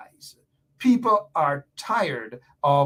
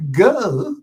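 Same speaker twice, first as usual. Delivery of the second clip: louder, scornful put-on "Go"; mimicking Jack's tone; 4 semitones higher, 7 dB louder than usual. Radio host imitating the opposition leader, scornful put-on voice. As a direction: sneer it the way Jack would. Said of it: "With that tone of voice".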